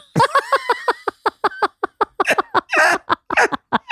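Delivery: long high-pitched cackling laugh; real helpless laughing fit; big laugh. Real, not acted. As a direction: crack up laughing, high and drawn out. Cracks up at joke about paying.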